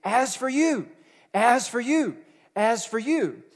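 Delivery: insistent, rising "as for you"; emphatic repetition. Retold or imitated. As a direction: drive home "as for you" three times. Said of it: "Paul says to his son".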